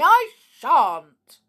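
loud, high refusal; defiant shout. A narrator's reading. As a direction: shout back in stubborn defiance. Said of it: "shouted Sneery".

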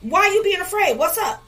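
fired-up pulpit cadence; preaching at full force. Acted. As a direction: rise into the climax of the sermon.

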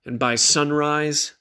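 ordinary delivery, nothing marked

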